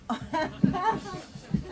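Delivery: high, loud real laugh; sudden amused laughing fit; burst of laughter. Real, not acted. Speaker reacting to the interruption about the microphones, laughing out loud.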